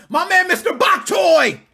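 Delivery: big excited shout-out; loud celebratory call-out; hype shout-out. Real, not acted. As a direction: yell it like a hype man.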